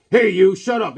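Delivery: hammed-up redcoat shout; redcoat yelling. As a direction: yell it like a bullying redcoat. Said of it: "he goes".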